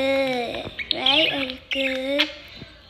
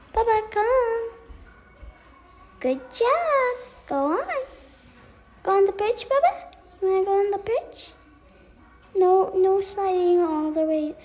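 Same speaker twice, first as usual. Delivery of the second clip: high, louder pet-talk praise; high coaxing; 5 semitones higher, 4 dB louder than usual. Real, not acted. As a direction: cheer the bird on in a high voice. Coaxing the bird.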